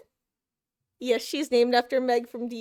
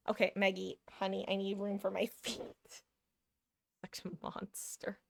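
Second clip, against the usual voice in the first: soft pleading to cat; 3 semitones lower, 8 dB quieter than usual. Real, not acted.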